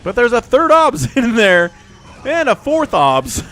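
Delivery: high, loud, rising excitement; excited shout. Real, not acted. Caster hypes viewers as the obs keep coming, excited shout. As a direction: call the surprise comeback at full volume.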